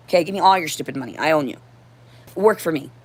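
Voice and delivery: ordinary talk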